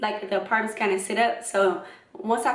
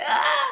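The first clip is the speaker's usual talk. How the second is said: high startled shriek with a laugh; 3 dB louder than usual. Retold or imitated. Startled yelp seeing a rat in the mud.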